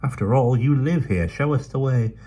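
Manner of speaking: brisk London urchin, chatty; Dido's voice. Acted. Dido urges.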